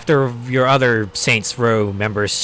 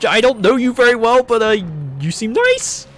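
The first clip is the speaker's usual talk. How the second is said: much higher, louder timid voice; awkward compliment; 11 semitones higher, 5 dB louder than usual. Retold or imitated. New crew member to the hero, timid high awkward voice. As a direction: squeak out a clumsy compliment. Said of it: "just like".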